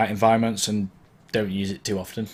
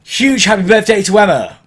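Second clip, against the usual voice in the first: much louder and higher club shout-out; 11 semitones higher, 11 dB louder than usual. Shown as a demonstration. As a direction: hype the birthday girl to the crowd. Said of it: "You don't need to think about it".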